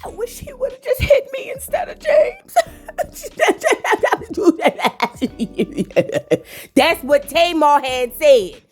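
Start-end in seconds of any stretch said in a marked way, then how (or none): 0.0-2.5 high, wailing fake sob; hammed-up crying impression; mock crying Tamar. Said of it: "Tamar said". 2.9-6.4 real laugh. Real, not acted. Laughing after the impression.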